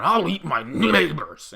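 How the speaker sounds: loud, slow, guttural growl; outboard motor voice. Retold or imitated. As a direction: rasp it like a sputtering engine full of whiskey. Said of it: "outboard motor ... voice going".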